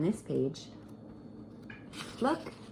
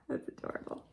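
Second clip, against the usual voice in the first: unvoiced soft aside; whispered adorable; 12 dB quieter, faster than usual. Real, not acted. Teacher cooing over Seymour to the children, whispered. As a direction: breathe it out fondly. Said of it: "Look at little Seymour".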